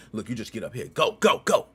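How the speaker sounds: ordinary delivery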